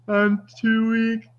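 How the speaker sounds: high, drawn-out, hammed-up pleading; McDiarmid's feeble Palpatine. Podcaster mocking a terrible film performance, hammed-up feeble plea. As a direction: overact a weakling begging for life, very slow. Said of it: "McDiarmid is amazing, e-, even when he's absolutely terrible".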